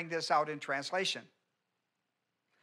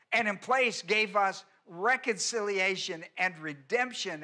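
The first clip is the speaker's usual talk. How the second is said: raised pitch, building into driving, emphatic cadence; impassioned preaching; 4 semitones higher than usual. Real, not acted. Preacher decrying ritual without remembrance to the congregation, impassioned. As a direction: preach it with mounting urgency, punching the key words.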